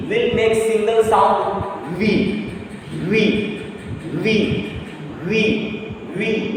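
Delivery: drawn out V sound for U E; teacher modelling the V sound for U E. Shown as a demonstration. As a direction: say the single sound V for U E. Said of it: "These two letters, these two vowels".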